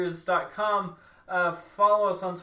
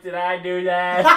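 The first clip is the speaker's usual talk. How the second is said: mock-innocent catchphrase, louder; Urkel; 6 dB louder than usual. Friend playing the tentmate as clueless, Urkel style. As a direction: lightly imitate a sitcom nerd's catchphrase. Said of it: "Sherman's like Urkel".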